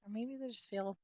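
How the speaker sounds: soft body-scan voice with long pauses; guided meditation. Real, not acted. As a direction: gently guide attention through the body, softly and unhurried.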